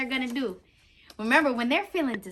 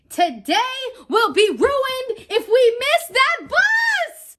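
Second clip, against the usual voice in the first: much higher, louder, slow panicked shout; urgent shout; 12 semitones higher, 11 dB louder, slower than usual. Acted. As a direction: yell to hurry before the bus leaves. Urgent mother in story.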